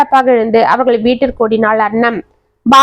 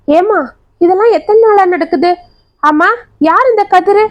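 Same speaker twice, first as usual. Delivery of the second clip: much higher, shaken voice; shocked alarm; 7 semitones higher than usual. Acted. Grandmother shocked after seeing the burn scar, high and shaken. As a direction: ask in disbelief and alarm.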